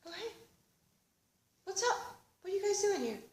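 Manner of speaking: high, soft, breezy innocence; sleepy, innocent surprise; casual roommate. Retold or imitated. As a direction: play it totally chill. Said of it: "and he goes".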